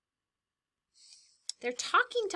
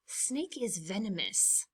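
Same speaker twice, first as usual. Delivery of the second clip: ordinary delivery, nothing marked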